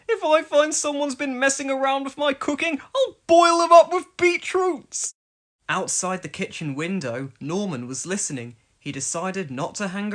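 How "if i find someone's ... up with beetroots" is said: loud, high cross bluster; angry threat. A narrator's reading. Elvis threatening whoever spoiled his cooking, loud angry bluster. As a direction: bellow the threat louder and louder.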